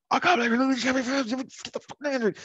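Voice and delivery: very high-pitched ranting parent; hammed-up screechy tirade; fly into a cartoon fit of rage; mock rage. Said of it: "sometimes I'll rage".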